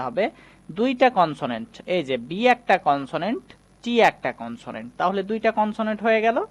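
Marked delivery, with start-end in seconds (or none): none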